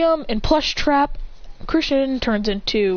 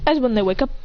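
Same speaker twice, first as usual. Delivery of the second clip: usual speaking voice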